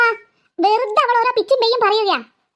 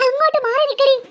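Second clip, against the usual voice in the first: ordinary talk, nothing marked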